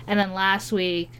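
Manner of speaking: ordinary talk, nothing marked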